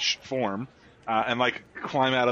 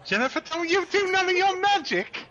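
very high put-on mother voice; hammed-up high-pitched telling off; scolding mother; 3 dB louder than usual. Mother forbidding Jennifer's magic, high hammed-up scolding.

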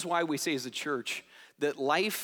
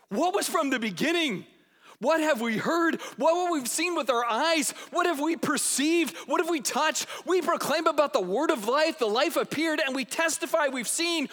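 higher and louder, breathless wonder; excited proclamation; 8 semitones higher, 4 dB louder than usual. Retold or imitated. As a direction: read it like you can't hold it in. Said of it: "Here's how it literally reads in Greek".

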